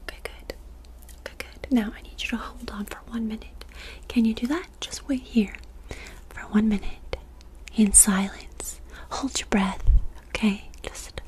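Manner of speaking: ordinary talk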